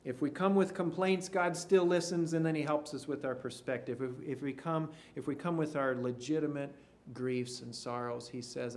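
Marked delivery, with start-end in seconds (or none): none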